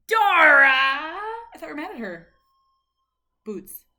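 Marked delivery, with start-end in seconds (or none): 0.1-2.3 high loud mock outrage; mock shock. Mother in mock shock at the cartoon for her son. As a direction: gasp in playful shock.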